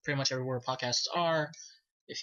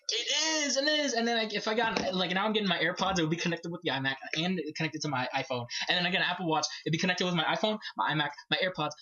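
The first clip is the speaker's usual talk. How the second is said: excited; 6 semitones higher, faster than usual. Real, not acted. Gushing over Apple integration.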